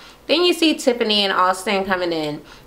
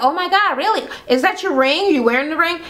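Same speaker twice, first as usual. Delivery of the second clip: put-on high-pitched girlfriend squeal of questions; excited gushing; 8 semitones higher than usual. Friends quizzing Tiffany about her engagement, high excited gushing. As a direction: imitate friends gushing over big news.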